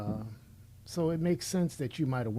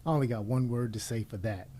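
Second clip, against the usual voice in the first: ordinary talk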